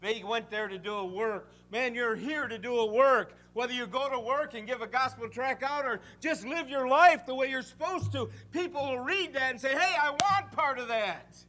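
rally the listeners to do a work; full-force preaching. Acted.

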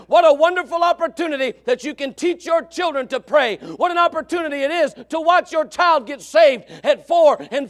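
loud, high, stretched-out sermon cry; fiery preaching. Real, not acted. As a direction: build the sermon to its peak. Preaching climax.

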